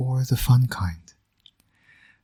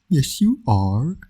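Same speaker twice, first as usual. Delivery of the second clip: slow, higher sing-song coo, rising; baby talk; 5 semitones higher, slower than usual. Acted. Villain dad cooing at his infant son, slow baby talk.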